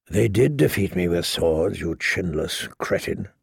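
low, soft contempt; quiet scorn. A narrator's reading. Knight corrects his chinless cousin, scornfully. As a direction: drop low and insult him quietly.

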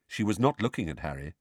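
ordinary delivery

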